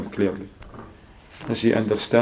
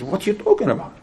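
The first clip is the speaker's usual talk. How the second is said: mock angry; 6 semitones higher, 4 dB quieter, faster than usual. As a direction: act out an incredulous, offended father. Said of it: "got very angry and furious".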